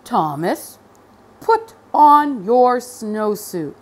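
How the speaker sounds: loud, slow authoritative order; the boss laying down the law; stern principal. A narrator's reading. Principal orders Thomas, stern and slow. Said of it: "best principal look".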